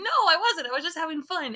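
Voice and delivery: high, loud retort; defensive protest. Retold or imitated. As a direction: protest like a kid being scolded. Acts out protesting to husband.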